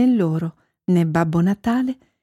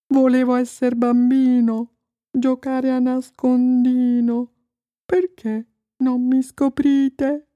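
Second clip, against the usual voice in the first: rhymed lament in a put-on voice, rising higher; misunderstood snowman; 4 semitones higher than usual. A narrator's reading. Snowman wishing to be a child, plaintive. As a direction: recite as a plaintive snowman, line by line.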